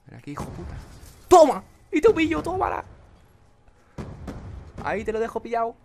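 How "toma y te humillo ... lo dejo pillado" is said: very high, loud victory yell; triumphant gloating shout. Real, not acted. Gamer screaming in triumph over a kill. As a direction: crow it over the beaten rival.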